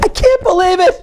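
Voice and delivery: high-pitched outraged cry; modelled disbelief. Shown as a demonstration. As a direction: send the voice right up in protest.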